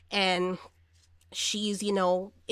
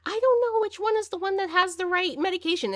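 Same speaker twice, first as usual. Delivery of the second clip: very high, sustained mock wail; imitating frantic wife; 11 semitones higher, 3 dB louder than usual. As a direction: whine it out high, mimicking a flustered wife. Wife calling out, in retold film.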